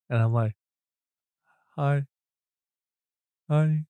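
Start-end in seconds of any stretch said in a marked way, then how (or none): 1.8-3.9 stiff, awkward repeated hi; a touch of starstruck awkwardness. Retold or imitated. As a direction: mumble it like you're starstruck and frozen. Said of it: "Like, awkwardly".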